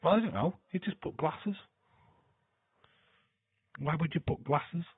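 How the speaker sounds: high, puzzled disbelief at the chat; baffled and amused; incredulous. Real, not acted. Mocking a chat comment.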